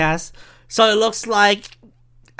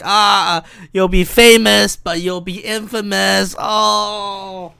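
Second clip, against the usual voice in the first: slow, drawn-out ominous curse; monkey's paw; 3 dB louder, much slower than usual. Pretend. Podcaster voices a monkey's paw granting wishes, mock ominous. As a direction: intone it like a hammed-up evil omen. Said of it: "that would be like a monkey's paw".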